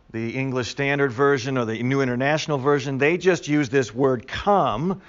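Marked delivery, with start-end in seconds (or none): 4.3-5.0 "come" pitched higher, drawn out; emphatic COME. Shown as a demonstration. Teacher quoting the translations' word, emphatic "come". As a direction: land firmly on "come".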